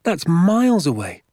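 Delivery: put-on high, loud child's protest; astonished cry. A narrator's reading. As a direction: exclaim it in boyish disbelief. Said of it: "I cried".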